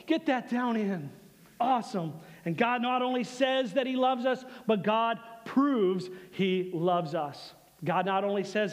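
normal talking voice

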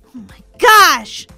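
loud, slow exasperation; exasperated outburst. Real, not acted. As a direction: lean hard and loud on gosh. Reacts to failing the jump.

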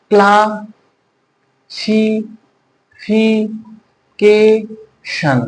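Classification in five long, level syllables with gaps. Shown as a demonstration. Teacher asking students to count parts, slowly split classification. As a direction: chant out classification very slowly as kla, si, fi, ke, shon. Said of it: "কয় ভাগে বলো বন্ধুরা".

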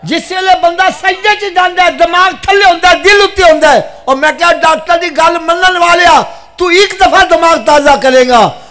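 very loud sermon cry, starting high and dropping at the climax; impassioned exhortation at full volume; full-force preaching. Real, not acted. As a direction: shout it out to the whole congregation.

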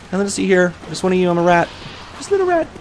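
high, sneaky little rat voice; squeaky rat voice. Pretend. Sneaking past enemies.

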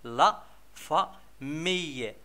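"لا فامي" as la, fa, miye with pauses; split into syllables. Shown as a demonstration. Teacher naming the whole family picture, "لا فامي" syllable by syllable. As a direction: pull "لا فامي" apart beat by beat. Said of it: "لي سيلاب اللي كيتكونوا منهم".

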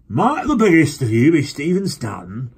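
high, loud forced-hearty stranger; a heavy-jowled man's clumsy cheer; clumsy joviality. Acted. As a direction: put on awkward friendliness. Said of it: "with clumsy joviality".

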